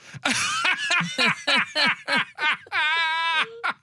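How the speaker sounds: high, helpless belly laugh; laughing fit. Real, not acted. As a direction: crack up uncontrollably. Host bursts out after big win.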